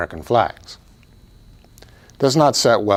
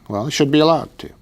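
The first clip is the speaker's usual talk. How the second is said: quick, higher mock objector's voice; imagined listener's objection; 5 semitones higher, faster than usual. Preacher voices a congregation member objecting, mildly mocking. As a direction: toss off the objection lightly, a bit faster. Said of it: "many of you would say".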